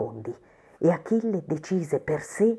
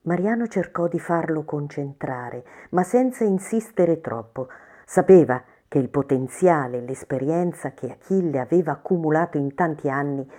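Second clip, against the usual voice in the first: ordinary delivery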